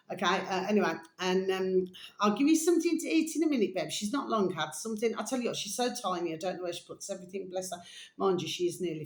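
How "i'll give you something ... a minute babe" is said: high, quick promise to a pet; fond pet voice, higher pitch; doting pet talk. Real, not acted. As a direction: coo a promise of food. Talks to her pet.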